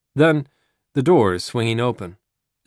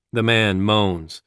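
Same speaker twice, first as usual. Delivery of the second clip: ordinary delivery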